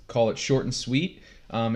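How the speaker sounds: ordinary talk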